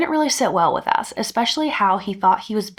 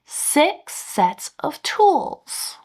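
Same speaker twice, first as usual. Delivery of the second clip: very slow, higher sarcastic count; 6 semitones higher, much slower than usual. Retold or imitated. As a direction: drag it out like counting to a child. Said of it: "pauses sarcastically to count".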